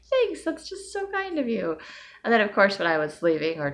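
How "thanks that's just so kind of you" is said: very high, sugary politeness; high forced-sweet thank-you; polite high thanks. Retold or imitated. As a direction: put on your nicest church voice. Her own polite reply.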